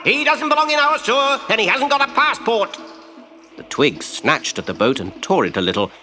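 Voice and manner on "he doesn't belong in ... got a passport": high, loud furious rat; rally the sewer with an angry yell; shouting rat. Acted.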